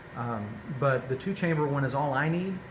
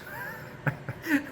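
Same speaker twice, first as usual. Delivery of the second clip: high giggle; 5 dB quieter than usual. Real, not acted. Laughs after the dog voice.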